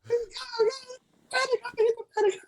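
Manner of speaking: high-pitched hammed-up yell of alarm; mock alarmed shout. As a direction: squeal it like breaking news panic.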